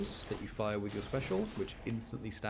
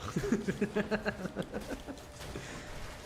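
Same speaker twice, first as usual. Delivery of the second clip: high, light laugh; 11 semitones higher than usual. Real, not acted. Gamer laughing at knowing only ha ha, high laugh. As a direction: laugh at own joke.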